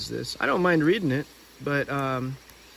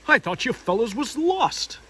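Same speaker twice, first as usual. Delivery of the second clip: much higher, slow and cheery; jolly Tigger voice; 9 semitones higher, 4 dB louder, slower than usual. Acted. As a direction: bounce in happy.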